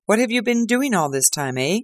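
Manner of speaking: detective confronting the hiding man; stern police inspector. Acted. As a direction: demand an answer from the culprit. Lestrade questions Oldacre.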